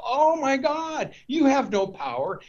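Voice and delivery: mock astonishment at the unmasked wizard; shocked gasp. Dorothy's friends to the exposed wizard, in mock shock.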